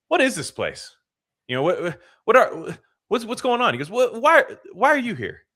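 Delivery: high-pitched, halting bewilderment; bewildered newcomer. Acted. Acted newcomer bewildered in hell.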